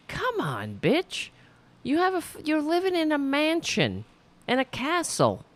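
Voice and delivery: high-pitched, incredulous reproach; exasperated scolding. Pretend. Host telling off a royal living in a mansion, exasperated. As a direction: scold them in a high, indignant voice. Scolding absent Meghan Markle over complaints.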